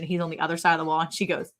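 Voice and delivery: ordinary talk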